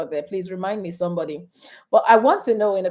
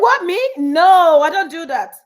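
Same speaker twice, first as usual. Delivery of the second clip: surprised denial; 6 semitones higher, 9 dB louder than usual. Retold or imitated. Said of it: "I said".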